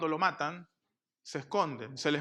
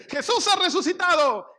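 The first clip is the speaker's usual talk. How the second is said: much higher, louder joyful announcement; breathless good news; 12 semitones higher, 8 dB louder than usual. Retold or imitated. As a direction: burst in with the news. Woman runs in with news.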